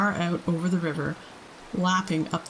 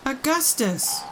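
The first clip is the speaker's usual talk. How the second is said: high urgent call; crying out; 7 semitones higher, slower than usual. A narrator's reading. Mr Wonka cries out to Augustus, alarmed. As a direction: cry out his name in alarm. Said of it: "cried Mister Wonka".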